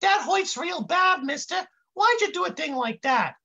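high, wounded intern voice; high kid voice. A narrator's reading. Smacked kid protests to Brick, high voice. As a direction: complain up high like a hurt kid. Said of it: "the kid says in this high voice".

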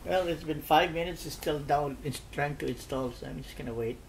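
ordinary delivery

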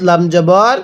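ordinary talk, nothing marked

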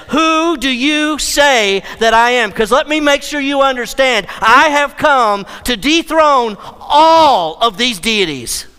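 slow, loud and commanding, each claim hammered home; emphatic Jesus. Acted. Jesus declares to his apostles he will conquer death, loud and emphatic. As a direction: proclaim it like a king declaring victory. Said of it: "Jesus is being emphatic".

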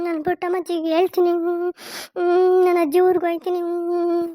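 whining, drawn-out sobs between protests; acted crying. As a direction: wail like an upset daughter refusing marriage. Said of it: "ಅಳ್ಬೇಡ, ಸುಮ್ಮನೆ ನಡಿ". (ಅಳುತ್ತಾ ಹಠ ಮಾಡುವ ಧ್ವನಿ)

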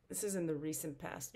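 ordinary talk

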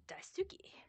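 unvoiced breathy name; hushed whisper. Real, not acted.

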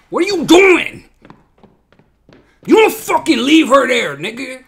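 high, loud furious shout at the screen; angry yelling. Real, not acted. Viewer yelling at a character who left her, furious. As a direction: scream at him for abandoning her.

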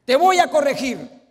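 hammed-up spouse vowing to correct; mock scolding. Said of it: "Ahora que te conozca".

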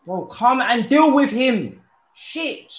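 high, loud and slow, pleading at the screen; exasperated yell. Real, not acted. Gamer yelling at his defender, high and loud. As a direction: yell at the useless defender to get stuck in.

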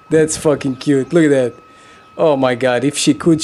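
normal talking voice

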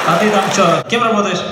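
ordinary talk

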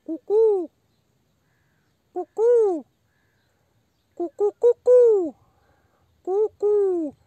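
high loud cuckoo call. Pretend. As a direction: sing out like a cuckoo.

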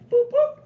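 high, loud "Boop, boop" beeps like a car remote; key fob chirp. Retold or imitated. Showing off the BMW to the guys, high loud fob beeps.

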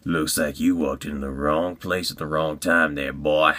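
low menacing brute; deep-voiced bully cornering someone; Underbite. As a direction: threaten in a low character voice. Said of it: "last character I'm gonna audition for is Underbite".

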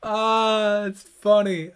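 can't hold the laugh in. Real, not acted. Laughing hard at a comment.

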